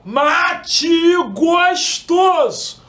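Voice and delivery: high, loud, slow sung-out street cry; singsong beach vendor call. Shown as a demonstration. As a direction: belt it across the sand like a mate seller. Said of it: "Aí eu falo".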